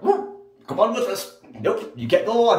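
high mock official voice, lightly voiced; a hint of a stern security agent stopping him; imitating TSA agent. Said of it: "they're like".

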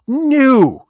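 high-pitched, drawn-out no; incredulous, stretched-out denial; emphatic no. Real, not acted. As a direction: swoop up high on a dismissive no. Emphatic answer to own question.

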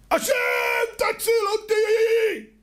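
very high, loud, long drawn plea; drowning kibbutz boy screams to God; cry out to God. Retold or imitated. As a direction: hurl the prayer up as a desperate scream. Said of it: "נתתי צעקה".

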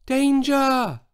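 high, thin little shout that barely carries; shout, but small and weak; a tiny high call of danger. A narrator's reading. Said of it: "and shouted".

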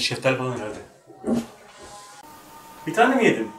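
usual speaking voice

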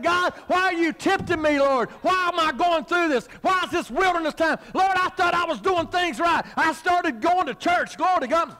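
high-pitched, preached-up complaint; mock whining. Preacher mimicking believers complaining to God in hard times, mock whining. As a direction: imitate a churchgoer whining at God. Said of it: "we start whining and saying".

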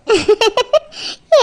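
dreamy, tickled giggle; shy giggle. Acted. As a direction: giggle with a rising lilt. Bride daydreams about her groom.

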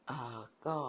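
ordinary talk

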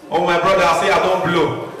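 ordinary talk, nothing marked

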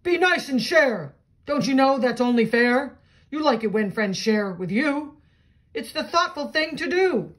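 tree yells. Acted. Reading a picture book, the tree scolds Bear, loud and high. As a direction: shout it down from the branches. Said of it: "The old tree yelled".

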